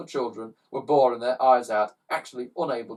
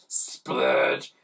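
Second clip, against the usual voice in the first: splurge; 4 dB louder than usual. A narrator's reading. Sound effect.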